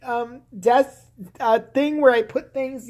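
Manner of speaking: high, slow airheaded drawl; a hammed-up high ditzy politician; ditzy Sarah Palin. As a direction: play her as a fumbling airhead. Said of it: "what do you read".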